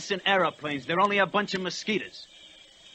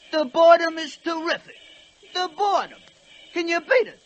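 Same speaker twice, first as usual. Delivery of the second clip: higher, mocking prissy lady voice; mimicking fussy old ladies; 10 semitones higher, 6 dB louder than usual. Telling the fellas, a mocking higher old-lady voice. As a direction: sneer at the complaining dames, a bit higher. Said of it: "old dames was complaining".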